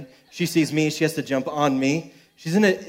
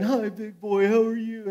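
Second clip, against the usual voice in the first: put-on cooing toddler; 6 semitones higher than usual. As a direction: coo it like a little girl to her baby brother. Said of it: "giving him a kiss on the nose and going".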